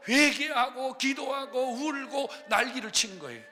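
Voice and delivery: loud, high, impassioned testimony; preacher lifting the congregation, full voice; preaching at full force. Real, not acted. Preacher telling the church of meeting Jesus, impassioned.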